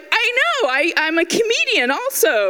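high-pitched gushing agreement; lightly hammed-up delight in yourself; mock boastful. Mock boasting reply to a compliment.

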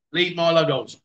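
normal talking voice

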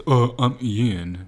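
slow, drawn-out caricature of himself. Acted. Husband showing how people imitate him, slow caricature. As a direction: drag out each word in self-parody. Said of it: "usually the impression is".

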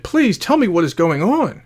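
mildly overwhelmed, halting in disbelief; tearful confusion. A narrator's reading. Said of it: "tears of confusion began to fall".

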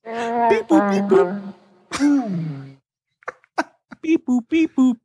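high robot beeping; R2-D2 beeps. Pretend. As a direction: chirp like a little droid. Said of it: "Like R two D two?".

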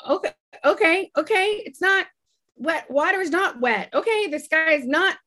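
high-pitched, hurried mock agreement; anxious placating. Acting out giving in to crazy people, anxiously placating.

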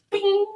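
high-pitched mouth ping; imitated arrow ping. Describing aiming straight up, high imitated ping. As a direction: sound out the arrow with a high bing.

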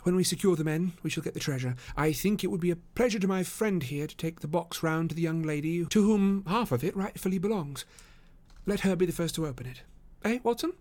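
high, easy voice for Holmes; composed detective. Acted. Holmes offering Watson the box errand, composed. Holmes plans the treasure handover.

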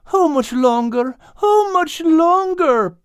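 very high, slow desperate plea; whiny high pleading cat. A narrator's reading. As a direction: beg as the miserable cat. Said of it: "pleaded Al".

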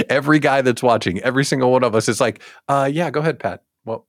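normal talking voice

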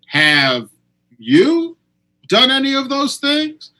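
high-pitched, pointed comeback question; pointed retort. Retold or imitated. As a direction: voice leaping very high on "you".

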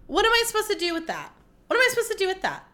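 high-pitched exasperated demand. Real, not acted. Podcaster venting about a ruined country, exasperated.

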